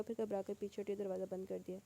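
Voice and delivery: ordinary delivery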